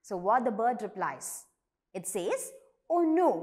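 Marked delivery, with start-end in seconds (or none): 2.9-3.3 high, loud, alarmed "oh no", then a fast question; flower reacts to news of trees cut down, dismayed and hurried. Acted. Flower's reply in story dialogue.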